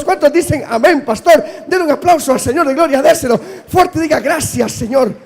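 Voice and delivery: loud high rallying call; rousing the congregation. Acted. Pastor stirring the church to applaud, loud. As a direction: whip up the crowd.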